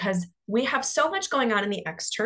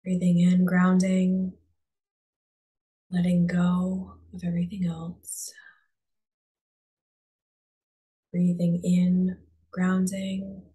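calm, unhurried meditation voice with long pauses; guided meditation. Real, not acted. Coach guiding listeners to close their eyes and breathe, guided meditation. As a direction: ease them inward, leaving space after each phrase.

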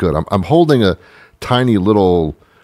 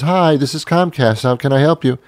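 lifted, sing-song operator voice; cheery phone operator; 7 semitones higher than usual. Retold or imitated. Comic imitating a Comcast receptionist on the phone, bright and sing-song. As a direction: play the friendly receptionist answering.

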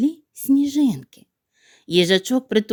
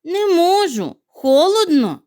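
refusing; 7 semitones higher, 4 dB louder, slower than usual. Acted. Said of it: "сказав їжачок".

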